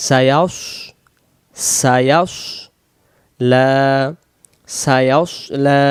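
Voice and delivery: "সায়াস লা" slow, as sa-yaws-la; "সায়াস" with a dark s, then a drawn-out "লা"; rounded mouth. Shown as a demonstration. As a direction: round the lips on "সায়াস", heavy s. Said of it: "মানে মুখটা গোল করব".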